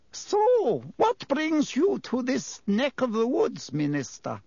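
high-pitched landlady, drawn out; Madam Rossmerter. A narrator's reading. Landlady asking the Minister what brings him here, high-pitched. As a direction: greet an important guest in a high voice. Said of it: "came Madame Rossmerter's voice".

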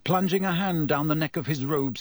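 ordinary delivery

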